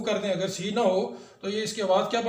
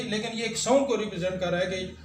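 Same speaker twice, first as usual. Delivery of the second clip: ordinary delivery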